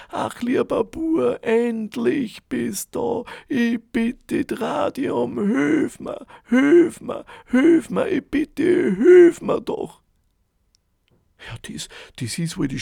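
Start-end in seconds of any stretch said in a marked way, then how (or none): 0.1-10.0 high, much louder pleading, building to a cry; loud wailing plea. A narrator's reading. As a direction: wail slowly, then rise to a desperate cry. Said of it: "So laut war das Klagen". (lautes Klagen, zum Schreien anschwellend)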